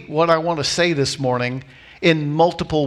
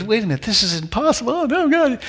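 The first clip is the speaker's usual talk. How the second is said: mock panic climbing higher; acted falling apart; 6 semitones higher, faster than usual. Believer doubting in the middle of a miracle, mock panic. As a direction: build from doubt into a high, hammed-up wail. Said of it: "just begin to fall apart".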